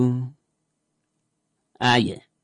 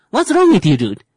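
much higher, louder indignant comeback; offended retort; 6 semitones higher, 5 dB louder than usual. Acted. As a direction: snap back as the offended friend, pitched way up. Friend offended by the remark.

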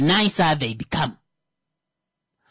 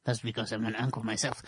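low, quick, hushed aside; quiet aside; 8 semitones lower, 11 dB quieter, faster than usual. Real, not acted. An uncle admitting his own share, quiet aside. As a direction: tuck it in softly and quickly.

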